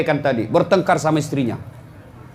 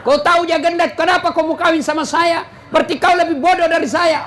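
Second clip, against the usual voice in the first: high-pitched mock quarrel; angry wife, acted; 10 semitones higher than usual. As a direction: fire back as an indignant wife. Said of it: "bilang juga istrinya". (menirukan istri marah membalas)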